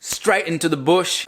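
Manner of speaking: imitating Steve Owen. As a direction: echo it in a broad Aussie accent. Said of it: "A gente usa bush aqui". (imitando o sotaque australiano, devagar)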